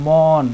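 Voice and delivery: মন drawn out slowly so the final vowel sounds; pronouncing মন slowly. Shown as a demonstration. Teacher slowly models মন for exam students. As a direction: stretch মন to let its ending vowel ring. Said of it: "দেখো অ আসতেছে".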